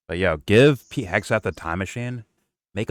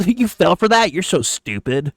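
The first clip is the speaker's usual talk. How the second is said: high-pitched, laughing taunt; mocking impression; 11 semitones higher, 5 dB louder, slower than usual. Host imitating Timmy taunting his followers, high and mocking. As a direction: jeer at the gullible, pitched way up.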